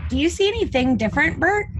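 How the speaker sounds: quick, sly question; teasing Ernie. Acted. Ernie showing Bert the swapped shirt, quick and teasing. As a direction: rattle it off playfully. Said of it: "he asked".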